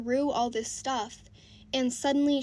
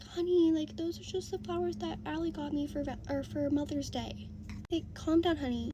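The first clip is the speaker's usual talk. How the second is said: higher, soft placating tone; soothing baby talk; 3 semitones higher, 5 dB quieter than usual. Retold or imitated. Mom soothing her jealous boyfriend, higher and soft like baby talk. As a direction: coo at him like calming a baby. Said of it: "talks to him like a fucking baby".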